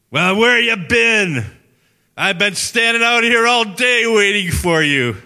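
very high, loud, drawn-out yell; screaming in someone's face. Retold or imitated. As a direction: scream it slowly, full force, as an outraged old man. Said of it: "he started screaming in my face".

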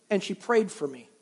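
normal talking voice

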